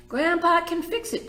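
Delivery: put-on high, bright boy's cry; eager child. Boy insisting to his mother, eager and high. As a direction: pipe it up high.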